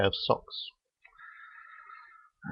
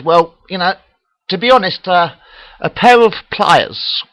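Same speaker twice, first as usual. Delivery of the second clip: strained Jesus; 9 semitones higher, 8 dB louder than usual. Acted. Jesus answers from the cross.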